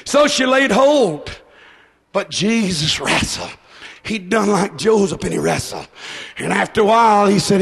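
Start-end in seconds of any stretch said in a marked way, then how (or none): none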